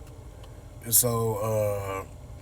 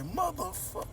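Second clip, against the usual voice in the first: mock curse; 13 semitones higher, 9 dB quieter than usual. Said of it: "He ain't say all that".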